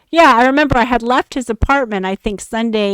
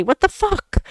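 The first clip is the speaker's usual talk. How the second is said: incredulous; 9 semitones higher than usual. Retold or imitated. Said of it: "And I'm like".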